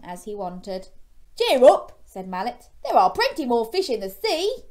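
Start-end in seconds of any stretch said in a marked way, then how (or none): 1.4-2.0 much louder, high cheery call; hearty cheer up. A narrator's reading. Mallet voiced heartily. 2.8-4.6 loud, high breezy tone; hearty advice. A narrator's reading. Mallet voiced heartily.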